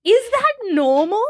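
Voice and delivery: high, loud worried question; pleading for reassurance; anxious. Retold or imitated. New mum anxiously asking midwife, worried.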